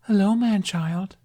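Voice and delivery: high, lilting mermaid hello; mermaid greeting. A narrator's reading. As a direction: greet him like a sea siren, sing-song. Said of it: "the green-haired maid greeted".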